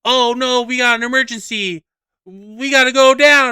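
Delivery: high, panicked character alarm; urgent cartoon announcement, rising in volume; Rocket Raccoon. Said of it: "Rocket Raccoon is just like".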